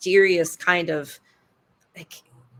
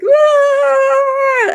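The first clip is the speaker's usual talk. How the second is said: very high, loud held scream; hammed-up yell from the dungeon; imitating a trapped scream; mock scream for help; 7 dB louder than usual. Pounding on the dungeon door.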